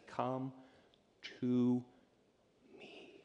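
fading to nothing on "me"; slow hushed invitation. Acted. Jesus's invitation, drawn out.

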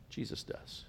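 soft reassuring whisper. Acted. Pastor whispers comfort to congregation, soft and low. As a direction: let it fall to a hush of comfort. Hushed reassurance after loud climax.

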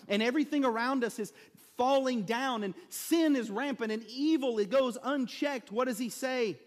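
preaching at full force. Acted. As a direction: press the church harder with every phrase.

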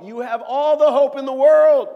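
very high, booming proclamation; full-force, emphatic pulpit cry; exultant preaching shout. Acted. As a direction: shout it out as good news. Preacher answers the hopeless quote.

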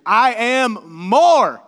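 Paul, voiced by the preacher, bragging at full volume; foolish boast. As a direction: shout your superiority like a fool. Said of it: "gonna go super foolish here".

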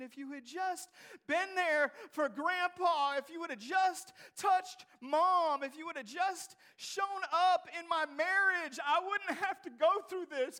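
rising from pleading to a loud anguished cry; desperate prayer. Acted. Acted desperate prayer, building up.

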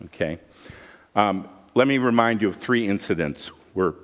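normal talking voice

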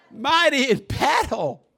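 high, loud, slow shout; exultant shout. Real, not acted. Preacher proclaims the Lord to congregation, shouting. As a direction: shout it like a victory cry.

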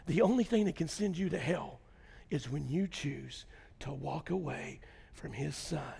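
very soft, low, nearly whispered sermon; hushed preaching. Acted. Pastor's quiet altar call to the congregation, near whisper. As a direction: bring it down to an intimate hush.

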